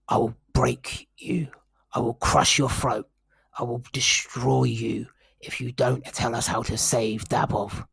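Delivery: loud menacing threat fading to a whisper; barbarian threat. Acted. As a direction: growl it, then whisper the threats. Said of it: "and he says".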